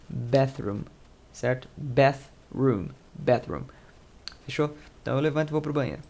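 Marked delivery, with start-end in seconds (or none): none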